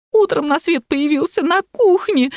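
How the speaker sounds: high-pitched mock wail; sobbing. A narrator's reading. Lapulya explaining his birth in the kitchen, high tearful wail. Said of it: "зарыдала Лапуля".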